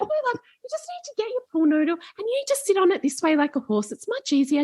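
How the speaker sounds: put-on high, quick, soothing old lady voice; old lady coaching a girl onto a pool noodle, high and gentle; kindly old woman. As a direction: explain it sweetly like a grandma. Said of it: "and she's like".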